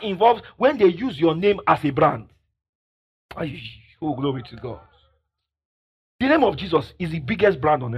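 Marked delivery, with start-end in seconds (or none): none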